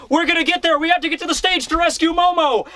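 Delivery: high-pitched mock urgency; rally the crew, pitched well up; urgent rally cry. Retold characters rallying urgently.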